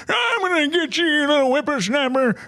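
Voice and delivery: put-on high, cracking old geezer shaking his fist; old man voice. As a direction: shout it like a cranky old man. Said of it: "that was just the old man".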